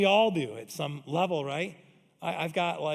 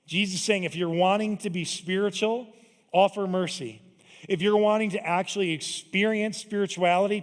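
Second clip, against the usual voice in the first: louder, building sermon delivery; preaching; 4 semitones higher, 7 dB louder than usual. Acted. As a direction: drive the point home to the congregation.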